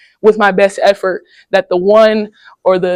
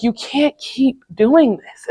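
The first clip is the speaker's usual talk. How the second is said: slow, soft, measured coach warning; a hint of a stern coach holding back; 4 semitones higher, 4 dB quieter, slower than usual. Coach scolding the player in a slow, measured, soft voice.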